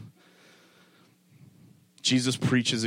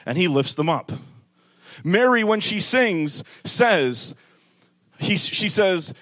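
loud, high, driving sermon build; preaching at full force; 11 semitones higher, 6 dB louder than usual. Acted. Preacher rousing her church, loud and high. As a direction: thunder it at the congregation.

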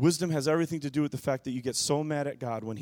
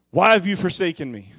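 a louder, higher echo of the cry; restrained anguished cry; 5 semitones higher, 10 dB louder than usual. Acted. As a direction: call out the question again, less intense. Cry recalled, less force.

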